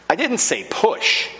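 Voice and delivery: put-on high, put-out child's protest; a hint of a sulky boy; indignant little boy. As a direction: complain upward like a muddy kid. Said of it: "looked up to heaven and said".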